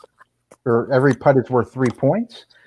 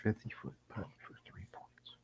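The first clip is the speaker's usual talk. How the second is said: golf commentator whisper; 2 semitones lower, 24 dB quieter, faster than usual. Acted. Hushed putting commentary.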